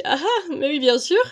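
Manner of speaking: high, loud sarcastic scoff; sarcastic mock. Her doubting mind mocking the entity: loud sarcastic scoff. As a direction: scoff at it like it's absurd. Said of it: "mon mental ... lui dit".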